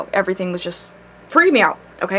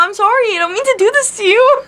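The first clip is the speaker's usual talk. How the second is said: much higher, cutesy sorry voice; mock apology; 12 semitones higher than usual. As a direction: play up the apology sweetly. Apologizing for cliffhanger to viewers.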